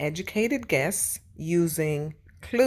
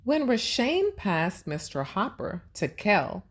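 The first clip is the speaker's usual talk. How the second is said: ordinary talk, nothing marked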